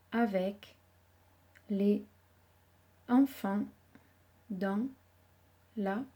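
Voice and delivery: slow, one word at a time; word by word dictation. Shown as a demonstration. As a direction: dictate each word with pauses. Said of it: "Il faut que vous mémorisiez cette phrase".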